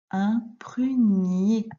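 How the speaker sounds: slow, drawn-out model reading; syllable by syllable. Shown as a demonstration. Teacher reading a new word to a pupil, syllable by syllable. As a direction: stretch out each syllable for learners.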